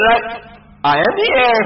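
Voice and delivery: ordinary talk, nothing marked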